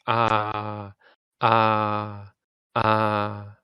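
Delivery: long open ah held out; long ah vowel held. Shown as a demonstration. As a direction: stretch the ah. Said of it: "The second one you say longer".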